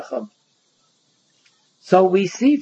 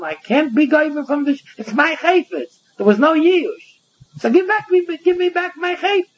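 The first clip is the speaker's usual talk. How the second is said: pitched higher, protesting owner demanding his item back; indignant owner; 10 semitones higher than usual. Pretend. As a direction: play the wronged owner pleading hotly for his property. Rabbi voices owner demanding item.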